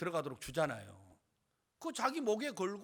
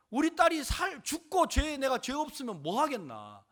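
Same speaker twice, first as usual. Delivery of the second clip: high, raised-voice objection; desperate protest; 8 semitones higher, 9 dB louder than usual. Acted. Father argues his daughter's life matters most, desperate protest.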